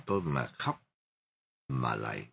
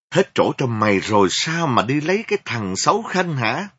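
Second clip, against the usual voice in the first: loud, high enraged shout; furious yell; 5 semitones higher, 9 dB louder than usual. Acted. Enraged mother yells at her daughter. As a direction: hurl the question at her. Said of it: "Má tôi giận đến nỗi".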